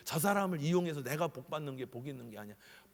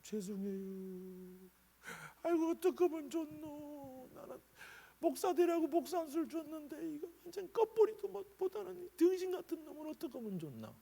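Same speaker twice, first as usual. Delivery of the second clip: tearful prayer; 12 semitones higher than usual. Retold or imitated. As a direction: plead with God through tears. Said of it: "그 제가 울었어요".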